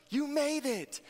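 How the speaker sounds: put-on cheering a child. Parents telling their son he reached London, cheering. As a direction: sell him on his big achievement. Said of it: "And they're like".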